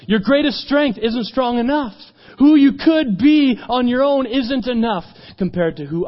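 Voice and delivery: high, loud, slow declaration; fervent, measured proclamation; emphatic challenge. Retold or imitated. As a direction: stretch each phrase with preaching force. Jesus challenges in preaching.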